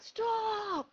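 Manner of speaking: high, drawn-out "stop"; mock call-out. Runner stopping her in the street, mock high-pitched call. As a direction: imitate a man flagging someone down.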